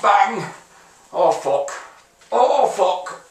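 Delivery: ordinary talk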